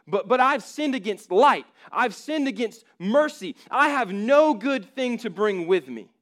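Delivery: the sinner insisting he is unworthy; pleading sinner. Acted. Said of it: "dialogue between a person".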